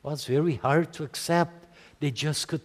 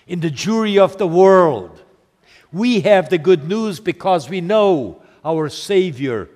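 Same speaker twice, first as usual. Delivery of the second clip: louder, rising preacher's proclamation; full-force preaching; 5 semitones higher, 8 dB louder than usual. Acted. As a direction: build it up like a preacher in full flight.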